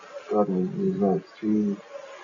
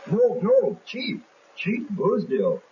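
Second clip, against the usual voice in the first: pleading sergeant; 10 semitones higher, much slower than usual. Acted.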